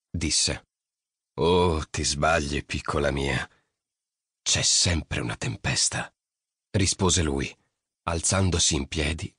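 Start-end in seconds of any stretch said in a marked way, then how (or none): 1.4-6.1 voce bassa e lenta, enigmatica; uomo misterioso. A narrator's reading. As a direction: correggila piano, con mistero paterno. Mercurio corregge Nina. (voce bassa e lenta, enigmatica)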